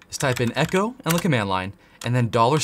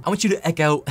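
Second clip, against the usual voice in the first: slipping into a British-Australian accent; accidentally Aussie-sounding, a touch higher; 3 semitones higher, faster than usual. Real, not acted. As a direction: let an Australian lilt creep into the vowels. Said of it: "I just went British or Australian".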